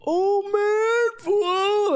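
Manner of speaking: high, wailing drunk mock-cry; drunk whine. Gabriel drunkenly wailing to his friend on the couch, high mock-cry. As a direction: whine it like you're wasted and near tears. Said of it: "like all fucked up".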